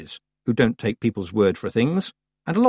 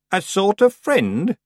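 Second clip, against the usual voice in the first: put-on "A sort of friend?" slow, rising, higher old woman's tease; teasing question; 10 semitones higher, 4 dB louder, slower than usual. A narrator's reading. Old Miss Treason needles Tiffany, slow rising tease.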